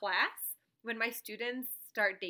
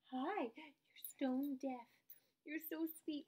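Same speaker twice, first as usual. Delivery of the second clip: soft, higher cooing; affectionate pet talk; 4 semitones higher, 10 dB quieter than usual. Real, not acted. Cooing to her old deaf pet, affectionate. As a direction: coo softly and sweetly.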